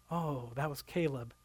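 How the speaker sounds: a soft, low dawning oh; quietly realizing his mistake; hushed realization. Retold or imitated. Father realizing he snapped at his son, hushed.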